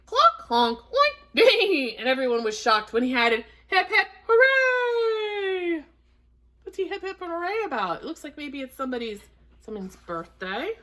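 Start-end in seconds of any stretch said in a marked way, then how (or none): 0.1-1.9 playful cluck, high oink, whinny; singing animal noises. A narrator's reading. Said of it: "Duddle danced and sang". 3.7-5.8 high cheer, long drawn-out hooray. Acted. Duck cheering in story. 6.7-10.7 quiet aside. Real, not acted. Aside to the listening children.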